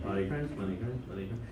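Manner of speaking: soft, low, rushed repetition; rapid murmur. Retold or imitated. Priest handing out communion, murmuring soft and low. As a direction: murmur it over and over. Repeating communion formula quickly.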